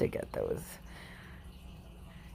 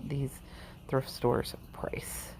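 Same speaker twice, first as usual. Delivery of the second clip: breathy aside whisper; 8 dB quieter, slower than usual. Real, not acted. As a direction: whisper it like a secret. Aside on thrift store pricing.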